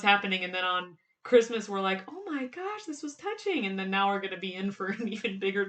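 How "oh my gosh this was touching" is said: high, soft imitation of moved viewers; mock touched. General audience on Christmas, mock touched and tender. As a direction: gush softly in a high, put-on voice.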